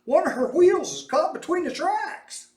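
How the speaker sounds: high, loud panicked shout; driver shouting. A narrator's reading. Trolley driver shouts back to Mose, high panicked shout. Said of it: "the trolley driver shouted".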